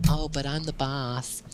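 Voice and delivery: lightly camp smug boss. Retold or imitated. Host voicing a smug capitalist heir with a light camp lilt.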